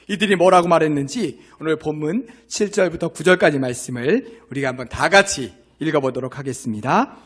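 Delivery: normal talking voice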